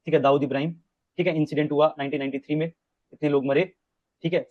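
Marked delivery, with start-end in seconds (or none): none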